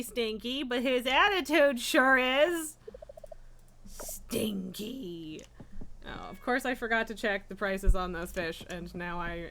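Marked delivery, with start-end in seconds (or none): 3.9-5.4 whispered, drawn out; whispered tease. Real, not acted. Owner whispers a tease about her cat's attitude. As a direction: breathe it out as a secret tease.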